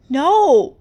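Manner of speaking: high, loud anguished cry. Acted. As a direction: refuse it with all your strength. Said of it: "Rumi cried".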